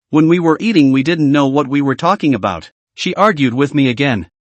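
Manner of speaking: plain reading voice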